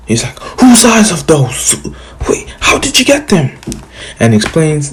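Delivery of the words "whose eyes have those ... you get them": much higher, alarmed questions; shocked. Retold or imitated. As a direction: blurt it out in shock. Hokage shocked by eyes.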